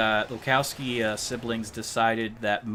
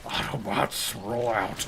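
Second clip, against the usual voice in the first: Optimus Prime impression; slower than usual. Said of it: "Transformers impression".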